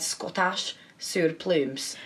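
lightly mimicked Scottish lilt; imitated Scottish accent. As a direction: copy a Scottish accent, drawn out. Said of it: "You said".

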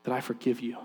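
low, soft, retold forgiveness; gentle forgiveness. Woman telling the man who hit her, gentle and hushed.

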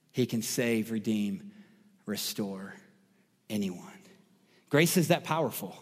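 low, softened, with weighty pauses; solemn preaching. Real, not acted. Preacher assuring listeners grace can save anyone, solemn preaching. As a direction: let each phrase sink in quietly.

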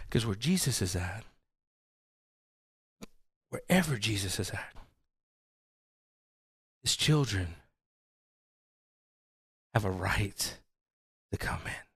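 soft, hushed conviction fading to a whisper. Acted. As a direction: draw them in quietly. Hushed intimate conclusion.

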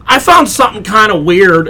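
lightly mock the hero's stupid-sounding delivery; mocking Eddie Brock. Making fun of the trailer's opening, mocking imitation. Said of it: "sounds so stupid when he's like".

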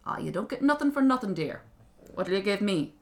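loud, pushy imp; crafty little man voice; haggling little creature. Acted. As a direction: drive a bargain like a leprechaun. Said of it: "the little thing said".

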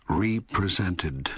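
"represented" with a long, stressed REE before "presented"; "represented" said as two parts, REE-presented; REPRESENTED split as re-presented. Shown as a demonstration. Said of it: "That is".

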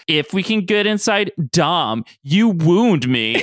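high, drawn-out theatrical hurt; flamboyant Pietro. Retold or imitated. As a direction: play the wounded diva, stretching the last words. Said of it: "very adorable, flamboyant lines".

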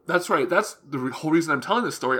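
ordinary talk

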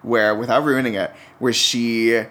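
ordinary talk

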